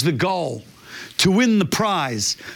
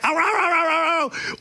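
high mock yelps; high imitated barks; imitated dog yelping; 10 semitones higher than usual. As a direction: yelp like a dog pulled back. Dog pulled back from food.

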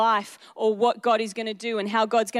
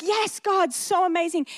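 gushing praise; 6 semitones higher than usual. Retold or imitated. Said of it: "the one that was like".

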